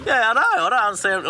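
high, innocent mock cluelessness; playing it off. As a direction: act dumb, high and light. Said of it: "trying to play it off".

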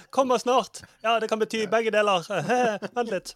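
high, put-on nervous laughing voice; nervous laughter. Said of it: "Sånn nervøs latter". (påtatt nervøs latter, høy stemme)

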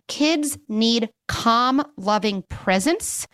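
usual speaking voice

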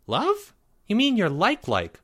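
put-on high, rising kid voice; squeaky disbelief; incredulous young boy. A narrator's reading. Boy questioning his friend's big word, high and incredulous.

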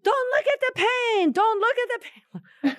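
high, loud mock yell of the old teacher; imitating teacher's scream. Kinesiology teacher yelling at her students, high mock shout. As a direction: shout it like a tiny fierce teacher. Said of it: "her screaming that in her wonderful accent".

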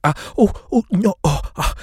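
high, loud "ah, oh" of pain; hammed-up yelping over scalding food; burnt-mouth yelps. A narrator's reading. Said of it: "Those chips had burnt the roof of my mouth".

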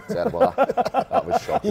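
laughing. Real, not acted. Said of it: "He set you up, though".

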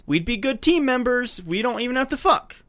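wheedling suitor. Retold or imitated. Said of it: "and she's like".

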